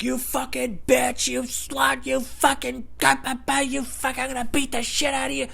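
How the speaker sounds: high, drawn-out mock raving of a furious customer; mock unhinged rage. Customer threatening a female coworker, mock unhinged rage. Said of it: "losing his fucking mind".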